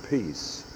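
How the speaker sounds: Peace set apart and dropped softly; stress on peace. Shown as a demonstration. Priest stressing Peace to his congregation, set apart and hushed. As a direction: give Peace alone, weighty and hushed. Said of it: "Emphasis on the word peace".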